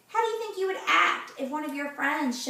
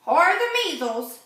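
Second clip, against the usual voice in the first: loud, higher doctor's voice; 4 semitones higher, 8 dB louder than usual. A narrator's reading. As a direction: chime in loudly as another doctor. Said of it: "said Doctor Sponge".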